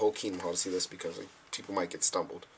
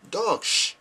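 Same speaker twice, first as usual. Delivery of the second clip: much higher, louder blunt answer; imitating Dutch brother; 10 semitones higher, 6 dB louder than usual. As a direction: blurt it like the casual brother. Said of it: "And he said".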